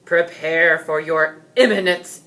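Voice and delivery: loud knightly battle cry; heroic yell. A narrator's reading. Knight yells at the dragon while charging. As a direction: charge in shouting a threat. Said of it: "George yelled".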